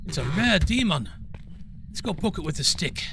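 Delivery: mock Australian accent, Crocodile Hunter style; hammed-up Australian outback accent; bad Australian accent for a laugh; terrible Australian accent. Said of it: "That is my terrible Australian, uh, accent for the day".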